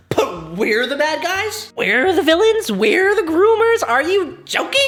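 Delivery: high-pitched, incredulous outrage; hammed-up offended protest; indignant disbelief. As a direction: throw each question back, voice climbing. Indignant retort to the meme.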